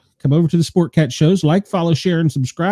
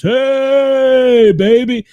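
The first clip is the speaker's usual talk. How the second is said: long drawn-out "hey", pitched up; imitated baritone greeting; 6 semitones higher, much slower than usual. Old boss hears Joey's room-entering greeting imitated, drawn-out baritone-style call. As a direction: croon it out like a mating call. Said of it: "real deep baritone voice".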